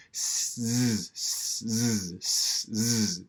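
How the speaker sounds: drawn-out unvoiced "s-" against buzzing voiced "z"; alternating S and Z. Shown as a demonstration. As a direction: hiss "s-", then buzz "z", back and forth. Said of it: "if you go back and forth".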